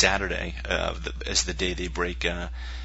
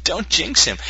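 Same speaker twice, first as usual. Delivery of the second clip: higher-pitched mock warning; mock pleading; 11 semitones higher, 6 dB louder, slower than usual. Host after the hearse slip, mock pleading. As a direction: plead with them not to tempt fate.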